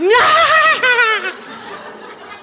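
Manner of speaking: gleeful high cackle; high cackling laugh. Real, not acted. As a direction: cackle with delight. Laughing at his screaming.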